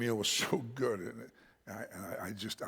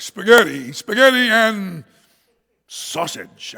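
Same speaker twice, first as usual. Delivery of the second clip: booming shout, higher on the repeat; aggressive loud ordering; 7 semitones higher, 17 dB louder, slower than usual. Retold or imitated. American ordering from nervous waiter, aggressive shout. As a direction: shout the order, stretching the second go. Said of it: "and my aggression".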